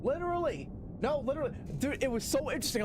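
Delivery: excited. Real, not acted. Excited about the game's twist.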